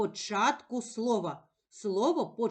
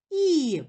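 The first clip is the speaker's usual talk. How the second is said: "і" loud, high and falling; stressed vowel; 5 semitones higher, 7 dB louder than usual. Shown as a demonstration. Teacher naming the stressed vowel, emphatic. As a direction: punch out a lone "і" as the stressed vowel. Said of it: "Наголошений голосний".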